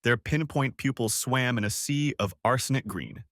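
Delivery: usual speaking voice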